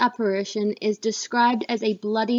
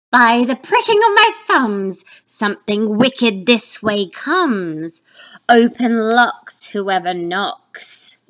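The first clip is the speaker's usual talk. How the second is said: loud, slow, ominous witch warning; 7 dB louder, slower than usual. Acted. Witch announcing Macbeth's approach, loud slow and ominous. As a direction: sense evil coming, drawn out. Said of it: "This is the third witch".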